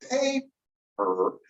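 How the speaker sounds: PAPER with an airy first P, plain second P; puff on first P. Shown as a demonstration. Teacher showing the two Ps in a word, PAPER with first P puffed. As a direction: say PAPER split slowly, puffing only the first P. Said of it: "doesn't have a puff of air".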